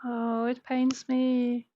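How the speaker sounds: ordinary talk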